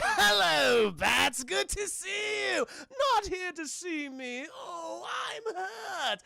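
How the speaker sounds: high, loud manic villain with a theatrical taunt; Joker impression. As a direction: tease Batman like a gleeful madman, savouring every word. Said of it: "a really good Hamill Joker".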